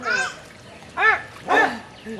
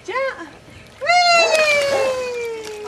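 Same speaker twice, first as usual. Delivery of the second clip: ordinary talk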